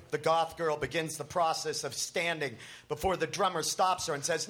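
ordinary delivery

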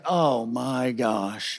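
very slow, hushed and low; quiet sinking realization; dread. Retold or imitated. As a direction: draw it out softly in mock dread. Kid dreading trouble after the knock.

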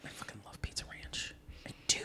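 hushed, breathy whisper of a guilty pleasure; a low whispered admission; whispered confession. Real, not acted. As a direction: whisper it like a secret. Confessing love of Pizza Ranch.

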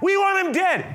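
high, forceful crowd cry; mob demand. Retold or imitated. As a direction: imitate an angry mob calling back. Mob shouts back at Pilate.

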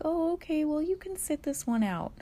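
high, sugary mock teacher voice; soft indulgent teacher. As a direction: parody a pushover teacher giving in. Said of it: "the teacher would be like".

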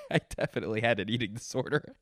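real laughter breaking into the words; laughing. Real, not acted. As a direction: say it through helpless giggles. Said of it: "the reason why I'm laughing".

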